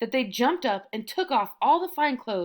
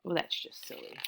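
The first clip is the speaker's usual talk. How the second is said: whispered aside; 4 semitones lower, 10 dB quieter than usual. Real, not acted. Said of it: "aside whispered".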